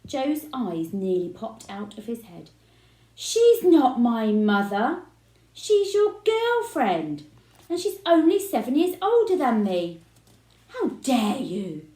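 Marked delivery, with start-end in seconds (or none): 3.1-10.0 shouting. A narrator's reading. Joe yells back at dad. 10.7-11.9 slow, indignant fury; fuming. A narrator's reading. Father fuming at his rude son. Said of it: "fumed Mister Spud".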